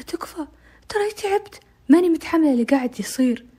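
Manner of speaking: high, pleading voice breaking; high tearful plea; desperate begging. Retold or imitated. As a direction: beg him, worn out. Exhausted girl begs captor.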